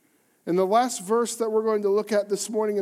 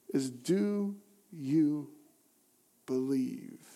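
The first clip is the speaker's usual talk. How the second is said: slow, hushed and lower; intimate question; 5 semitones lower, 7 dB quieter, much slower than usual. Acted. Pastor asking the congregation gently, slow and hushed. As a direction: lean in and ask it softly.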